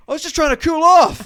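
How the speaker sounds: high, very loud comic yell; indignant protest. Retold or imitated. Caught man shouting back at the whole pool, mock indignant yell. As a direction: holler a hammed-up excuse.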